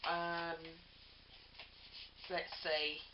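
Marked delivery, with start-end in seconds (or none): none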